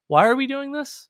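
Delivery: high-pitched mock bewilderment; what-is-the-point student whine; exasperated art student. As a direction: question it in a high, put-upon voice. Acting out baffled art students.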